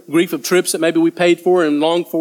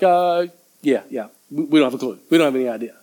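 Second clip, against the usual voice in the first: lower, hesitant confession; sheepish admission; 3 semitones lower than usual. Acted. Disciples admitting to Jesus they are lost, sheepish. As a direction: own up reluctantly, voice sinking.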